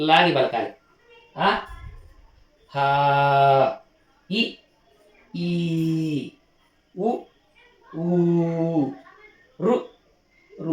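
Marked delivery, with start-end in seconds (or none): 1.4-10.7 each pair said short then drawn out; teaching vowel length, short then long. Shown as a demonstration. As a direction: contrast ఆ clipped with ఈ stretched long. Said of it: "తేడా గమనిస్తూ పలకాలి".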